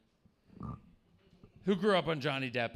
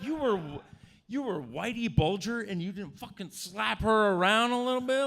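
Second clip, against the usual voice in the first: mock incredulous rant; 6 semitones higher, 6 dB louder than usual. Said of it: "I was like".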